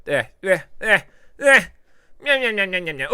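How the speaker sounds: high, loud cartoon munching; goofy high nom nom chant; silly nom noises. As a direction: play a hungry little critter.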